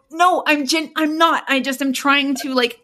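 high-pitched, insistent self-defense; acted protest. Acted quarrel with her sister.

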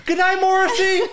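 high, loud teasing call; hammed-up adoring goodnight; mock bedtime goodnight. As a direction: sing out a pretend goodnight to an idol.

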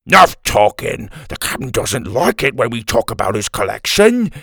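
loud, put-on goon voice; scolding big man. A narrator's reading. Big man scolding the old man, loud and irritated. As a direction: goon ranting to shut his mate up.

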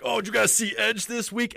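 high, loud mock-eager fan question. College athlete chatting up wrestling fans, eager. Imitates his eager younger self.

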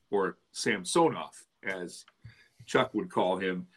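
normal talking voice

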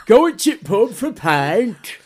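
high, drawn-out Yorkshire invitation; Yorkshire accent. Acted. Imitating a Yorkshire local asking friends out, broad Yorkshire accent. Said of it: "I always enjoy when you get".

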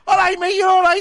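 high, loud, nervous reassurance; nervous cabbie. Retold or imitated. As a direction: squeak it out like a scared cabbie calming a fare. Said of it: "and I'm like".

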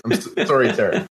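ordinary talk

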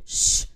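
sharp voiceless shush; hushing. A narrator's reading. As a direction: hush someone urgently. Moo hushes Minnie.

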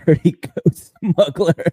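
laughing through a funny name. Real, not acted. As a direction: giggle out the name, slowly. Reading a funny username.

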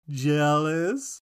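mock-sly taunt, rising at the end; imitated sly teasing. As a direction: tease with a Grinch-like grin, drawn up into a question. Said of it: "Grinch-like smile".

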